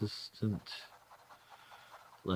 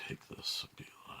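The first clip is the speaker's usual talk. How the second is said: whispering; 10 dB quieter than usual. Real, not acted. Whispered aside.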